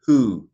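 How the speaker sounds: "who" with a clear h at the front; rough breathing. Shown as a demonstration. Teacher shows the breathing mark, "who" with initial h. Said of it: "with a rough breathing mark".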